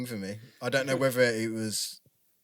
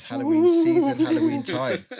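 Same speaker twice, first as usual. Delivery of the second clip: high, loud wailing ghost; 7 dB louder than usual. Acted. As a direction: moan it like a spooky ghost. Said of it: "That was my impression of a ghost".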